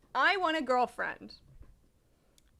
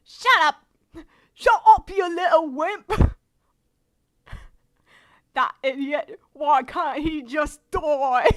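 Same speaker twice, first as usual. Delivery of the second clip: loud angry outburst in put-on British; British accent; 9 dB louder than usual. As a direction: shout it crossly with a British accent. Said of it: "do the British voice".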